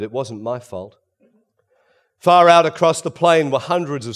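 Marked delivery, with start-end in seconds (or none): 0.0-1.0 low, soft murmur of protest; quietly murmured, pitch sinking. A narrator's reading. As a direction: let it drop to a sullen murmur. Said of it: "she murmured".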